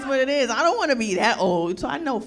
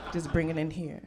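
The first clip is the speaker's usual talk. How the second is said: soothing hug voice; 7 semitones lower than usual. Pretend. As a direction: coax him into a hug.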